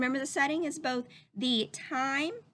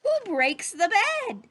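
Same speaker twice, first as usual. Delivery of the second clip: slow, louder dramatic reading; building storybook suspense; 4 dB louder, slower than usual. Acted. Teacher reading the chaotic ending to the class, slow and dramatic. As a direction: build each line like a chain of mishaps. Dramatic climax of the read-aloud.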